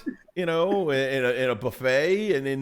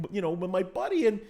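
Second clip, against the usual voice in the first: normal talking voice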